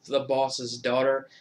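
ordinary talk

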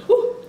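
sharp exhale at the heat; high whew from burning spice. Real, not acted. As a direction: blow out the chilli burn. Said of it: "Everything be burning".

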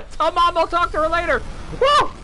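very high, loud whooping goodbyes; gleeful farewell shout. Pretend. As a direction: yell goodbye while being carried away. Waves off enemies while flung away.

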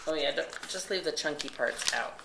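normal talking voice

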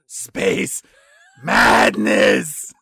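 drawn-out, manic cartoon shout; Ren Hoek. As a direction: build to a loud, crazed cartoon cry. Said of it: "what Ren Hoek calls".

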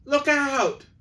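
very high, loud sudden shout; shouted warning. Acted. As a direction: yell a warning. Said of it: "called several voices".

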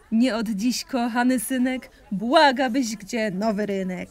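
usual speaking voice

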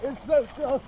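shrieking from cold. Real, not acted. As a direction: shriek it out in the freezing water. In the freezing water.

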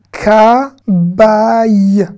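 KA and BAI drawn out, each on its own; syllable by syllable. Shown as a demonstration. Teacher showing learners how it reads, syllable by syllable. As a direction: sound out "Ka, bai" in two long syllables. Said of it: "ça se lit ça?".

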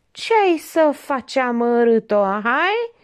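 high, slow, goading question; husband goading his wife; taunting husband. A narrator's reading. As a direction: rub her nose in it.

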